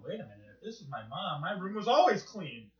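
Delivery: normal talking voice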